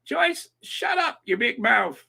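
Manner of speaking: hammed-up preacher retelling a telling-off; impersonated preacher quoting husband. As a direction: stretch it out high, in impersonation. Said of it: "David, he always says to me".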